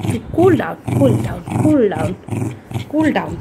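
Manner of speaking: slow, low, calming; soothing. Real, not acted. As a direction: slowly settle the dog down. Calming the agitated dog. (ধীরে নিচু গলায় শান্ত করা)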